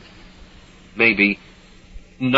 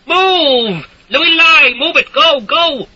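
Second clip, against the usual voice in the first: shouting orders; 7 dB louder, slower than usual. A narrator's reading. Soldier herding villagers.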